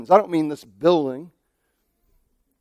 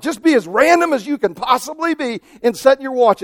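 normal talking voice

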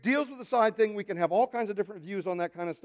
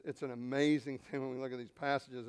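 ordinary talk

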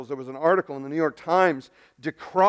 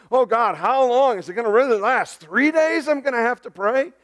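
higher, louder mock complaint; mock impatient prayer; 7 semitones higher, 4 dB louder than usual. Guy praying only two days, mock exasperated. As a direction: whine it like someone put out by waiting. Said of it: "not something a guy says if he's been praying for".

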